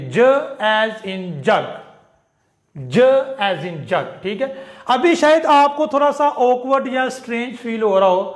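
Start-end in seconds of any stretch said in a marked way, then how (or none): 0.1-4.2 ज as in जग, drawn out slowly; slow teacher demo of ज; slow sound drill. Shown as a demonstration. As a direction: model ज as the English j sound. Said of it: "इसको हम ज कहेंगे".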